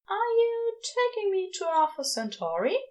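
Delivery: a high wondering ask; Aziraphale's voice. A narrator's reading. Aziraphale asking Crowley, in a high wondering voice.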